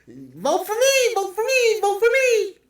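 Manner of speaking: high, loud, whiny mock pleading; hammed-up begging for votes, laughing into it; mocking Kamala.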